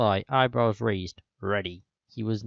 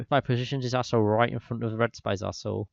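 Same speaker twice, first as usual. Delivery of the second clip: ordinary talk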